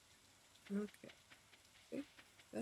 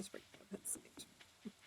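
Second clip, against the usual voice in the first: whispering; 10 dB quieter, much slower than usual. Real, not acted. Quiet aside while foraging.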